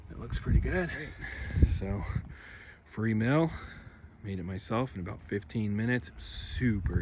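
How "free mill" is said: ordinary delivery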